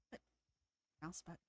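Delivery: ordinary talk, nothing marked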